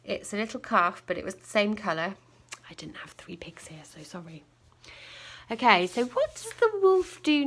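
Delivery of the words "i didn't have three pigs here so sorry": whispered aside; hushed, secretive aside. Real, not acted. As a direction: drop to a whisper for a quick apology.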